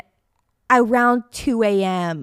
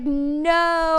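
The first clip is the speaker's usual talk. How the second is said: retold no; 7 semitones higher than usual. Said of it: "And I was like".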